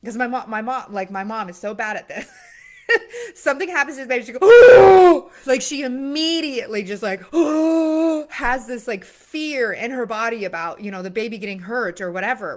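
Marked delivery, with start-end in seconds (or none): 4.2-5.2 imitated shocked shriek. Mom's fearful reaction to baby. 7.2-8.2 loud imitated gasp. Mom's fearful reaction.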